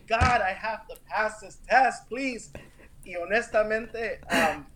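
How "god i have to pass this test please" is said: higher, earnest plea to God; retold pleading prayer. Praying to God before the ninth exam try, pleading. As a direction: pray it again, pitched up and pleading. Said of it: "I prayed and I prayed".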